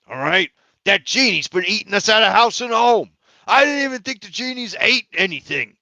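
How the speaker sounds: loud, high, pleading protest; exasperated head chef. Acted. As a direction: insist loudly that there's none left.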